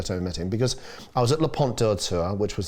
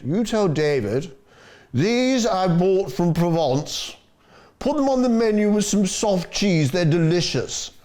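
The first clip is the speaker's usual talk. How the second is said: higher, slower, a grand patron giving orders; Terence's commanding voice; 8 semitones higher, 3 dB louder, slower than usual. Retold or imitated. Owner instructing the chef about Provence figs, slow grand command. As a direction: play the boss dropping off figs, deliberate and grand.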